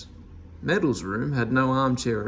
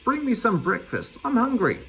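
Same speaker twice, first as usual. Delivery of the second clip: higher and peevish; a lightly voiced cross Meddle; cross demand; 9 semitones higher than usual. A narrator's reading. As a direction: insist like a spoilt man. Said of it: "said Meddle crossly".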